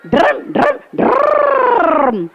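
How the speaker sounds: high revving trill with a long last roll; auto engine sputter. Shown as a demonstration. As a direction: demonstrate a high-pitched rickshaw motor. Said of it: "you have to say drum".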